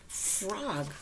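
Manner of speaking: ordinary delivery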